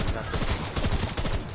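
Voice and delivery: slow whispered self-pep talk; psyching myself up. Real, not acted. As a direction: hush it to yourself, drawn out. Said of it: "so I'm telling myself".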